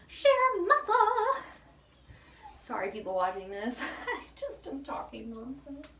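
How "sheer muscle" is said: high, loud, slow sheer-muscle boast; hammed-up bodybuilder brag; mock macho boast. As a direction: play the proud strongman, slow and loud. Joking flex about arms.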